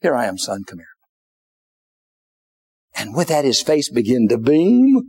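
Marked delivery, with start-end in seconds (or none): 0.0-0.9 low, soft reassuring mother; softly. Retold or imitated. Mother reassuring her lost son, soft and low. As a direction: gently call your child back. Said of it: "softly said to him".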